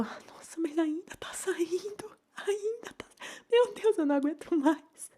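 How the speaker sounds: high whispered crying, then a sob; whimpering cry. Retold or imitated. To therapist, high whimpering overwhelmed cry. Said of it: "eu começo a chorar de nervoso".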